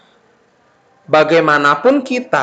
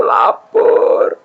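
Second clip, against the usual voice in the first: drawn-out, hungry little moan; 2 semitones higher than usual.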